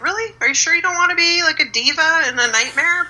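high, fast, disbelieving; incredulous. Retold or imitated. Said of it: "You're like".

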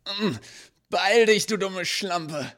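shrill furious shout; furious scream. Acted. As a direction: scream at her in bleeding rage. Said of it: "schrie wutentbrannt".

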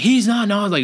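high, cutting-in imitation; interrupting daughter. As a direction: answer for him in a mock indignant high voice. Daughter answers over the patient.

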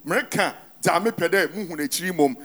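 ordinary talk

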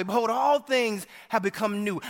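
normal talking voice

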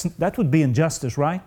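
normal talking voice

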